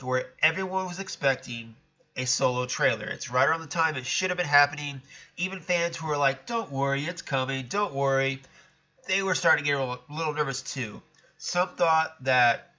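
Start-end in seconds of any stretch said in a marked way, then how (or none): none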